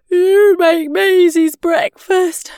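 high, loud, slow yawn. A narrator's reading. Sleepy Mum passes the job to Dad, yawning. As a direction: say it right through a big yawn. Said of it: "yawned Mum".

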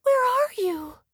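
tiny high frightened call; high, lost little voice; squeaky baby bat. A narrator's reading.

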